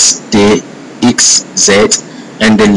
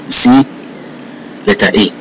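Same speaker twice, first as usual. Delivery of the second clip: ordinary talk, nothing marked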